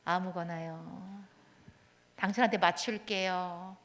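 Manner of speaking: slow, soft, self-effacing yielding; meek deferring. Retold or imitated. Partner deferring about what to eat, meek and soft. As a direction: give in meekly, slowly and softly. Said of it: "이건 아니라는 겁니다".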